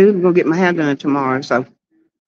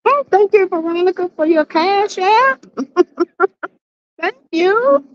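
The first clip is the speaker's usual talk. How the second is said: excited thanks; 12 semitones higher than usual. Real, not acted. Thanking a viewer for a donation.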